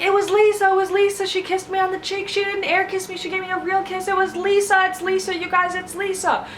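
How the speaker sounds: very high, pleading mock voice; frantic accusation. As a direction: blurt out the name in panic. Jam Jam insisting frantically.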